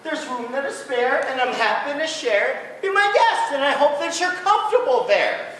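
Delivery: high, loud cheerful moose voice; a generous moose welcoming his guest; big-hearted moose. Acted. Fidwick speaks to bug.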